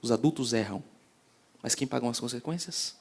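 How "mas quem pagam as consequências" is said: ordinary delivery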